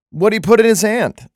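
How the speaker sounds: scandalized double take; incredulous. Real, not acted. Incredulous at the verse.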